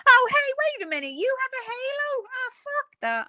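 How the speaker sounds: high, fast squeal of fake outrage; high mock indignation; mock surprise. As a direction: squeak it out in pretend shock.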